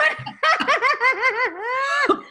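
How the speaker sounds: high, loud helpless laugh; real delighted laughter; burst of laughter. Real, not acted. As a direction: crack up at your partner's joke. Host laughing at co-host joke.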